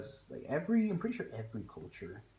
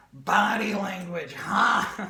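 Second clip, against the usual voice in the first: teacher's line; 8 semitones higher, 10 dB louder, much slower than usual. Retold or imitated. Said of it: "He went".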